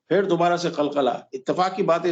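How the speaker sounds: ordinary talk, nothing marked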